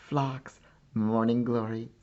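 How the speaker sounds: ordinary delivery, nothing marked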